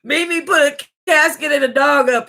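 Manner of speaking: high laughing retelling. Real, not acted. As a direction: repeat it through giggles. Laughing while repeating the line.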